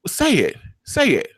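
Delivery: high, insistent prodding; lightly acted taunt; goading demand. Acts out character's taunting demand.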